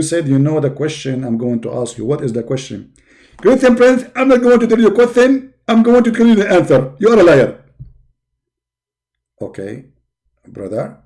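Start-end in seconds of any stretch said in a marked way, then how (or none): 3.4-7.6 high, loud, indignant preacher voice; Zakir Naik impression. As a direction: bark it like a self-righteous debater. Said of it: "My name is Zakir Naik".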